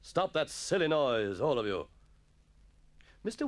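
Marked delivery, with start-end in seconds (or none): 0.0-1.9 slow, raised voice, falling at the end; scolding schoolmaster. Acted. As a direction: put down the rowdy boys firmly. Master quells the laughter.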